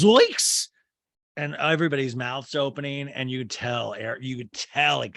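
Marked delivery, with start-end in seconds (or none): 0.0-0.7 shocked exclamation. Retold or imitated.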